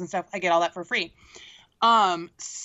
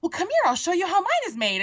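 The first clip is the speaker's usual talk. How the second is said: Ariel's invitation; 9 semitones higher than usual. Retold or imitated. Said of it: "she's like".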